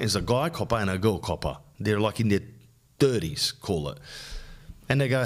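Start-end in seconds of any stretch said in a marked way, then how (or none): none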